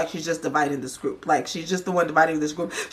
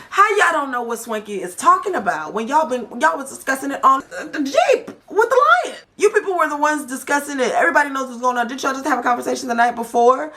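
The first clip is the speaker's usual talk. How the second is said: high-pitched, incredulous ranting; indignant disbelief; 7 semitones higher than usual. Real, not acted. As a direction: call them out, voice raised high. Host indignant at cast playing dumb.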